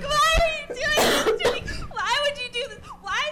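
high, loud, hammed-up wail; whiny best friend. Best friend in the bathroom corner, hammed-up high whine. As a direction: play the betrayed friend pleading through laughs. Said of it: "She's like".

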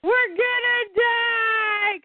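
high, slow hammed-up wail; mock panic. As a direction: wail the doom in fake terror. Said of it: "Damien goes".

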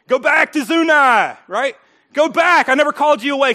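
loud preached command; loud divine command. Acted. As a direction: thunder the command. God's command preached loudly.

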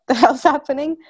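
half-laughing mock exasperation; laughing panic.

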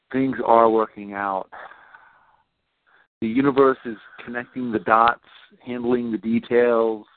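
low, unhurried visualization voice; guided visualization. Acted. Coach guiding a client through visualization, low and unhurried. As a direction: lead her gently into calm.

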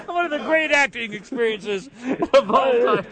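high, cracked-up delivery; barely holding the laugh in. Real, not acted. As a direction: praise it mockingly through laughter. Host laughs at the bad acting.

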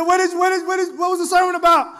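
high, loud voice of a curious friend. Retold or imitated. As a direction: ask as a friend who wants the gist. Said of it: "And they said".

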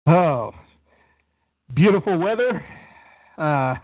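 breathless after laughing; out of breath. Real, not acted. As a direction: talk while catching breath. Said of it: "You sound a little out of breath".

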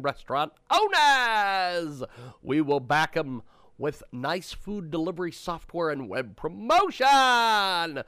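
loud, booming ad read; booming prize announcer. Acted. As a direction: sell it like a game show host. Host reads sponsor ad.

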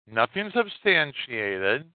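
ordinary delivery

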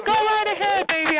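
high mocking go right ahead; sarcastic taunt. Real, not acted. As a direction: dare them, sing-song and mocking. Taunting a screaming caller.

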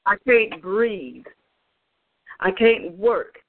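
slow, labored, each word dragged out; a struggling voice fighting for air; slow strained quote. Retold or imitated. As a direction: let the breath run short as you say it. Spirit speaks as if suffocated.

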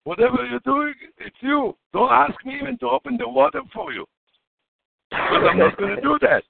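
high, emphatic, exasperated; animated protest. Real, not acted. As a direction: throw up your hands and wave it off. Refusing any involvement.

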